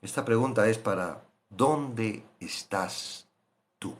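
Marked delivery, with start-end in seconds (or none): 1.5-3.9 gentle question. Acted. God asking the listener.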